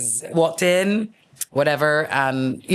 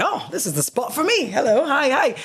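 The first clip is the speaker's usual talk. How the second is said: giddy flirty greeting; 11 semitones higher than usual. Retold or imitated. Flirting with cute concierge.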